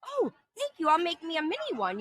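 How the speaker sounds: high sugary thank you; sweet thanks. Retold or imitated. To the kind lady offering coffee, high sweet thanks. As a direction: gush politely, pitch up. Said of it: "And I was like".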